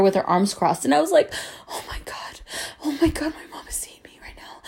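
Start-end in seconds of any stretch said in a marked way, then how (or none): 1.7-4.6 hushed mock dread; mostly voiceless, aghast whisper; horrified whisper. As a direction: whisper it in horror, as if caught. Horrified, crying as mom appears.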